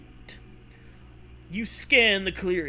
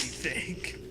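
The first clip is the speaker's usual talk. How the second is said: ordinary talk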